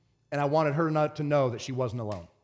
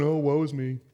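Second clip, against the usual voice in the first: mock self-pity; 3 dB louder than usual. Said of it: "no more walking around going".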